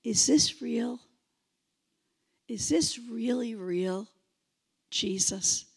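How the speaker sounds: low, hushed, tentative question; soft sincere asking. Shown as a demonstration. Preacher modelling a seeker's question to Jesus, quiet asking. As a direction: ask it privately, almost under the breath. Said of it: "all you have to do is say".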